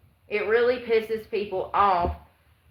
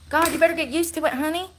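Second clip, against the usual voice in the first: higher, fast sassy clapback; sassy retort; 7 semitones higher, 3 dB louder, faster than usual. Acted. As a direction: snap back with sass.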